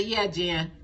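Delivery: audience reply. Pretend.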